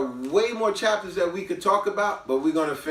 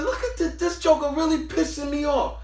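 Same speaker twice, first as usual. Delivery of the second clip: irked muttering; 8 semitones higher than usual. Retold or imitated. Retold irritation at rude woman.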